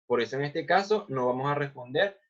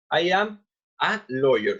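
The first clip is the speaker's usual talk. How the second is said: "I am a lawyer" slowed, article "a" set apart; slow model repeat; 4 dB louder than usual. Shown as a demonstration. Teacher shows students the article in the example, slow model repeat. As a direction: spell out "I am... a lawyer" word by word.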